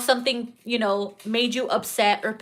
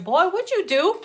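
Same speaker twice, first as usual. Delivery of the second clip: laughing suspicious question; 7 semitones higher than usual. Retold or imitated. Suspicious partner quote.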